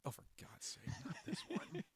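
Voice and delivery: hushed, embarrassed groan of protest; exasperated whisper. Real, not acted. Asked to tell the Skittles story, exasperated whisper. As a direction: whisper it in weary dread.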